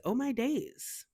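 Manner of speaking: bright high cute delivery, slowed; light and cute. Shown as a demonstration. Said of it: "light and cute".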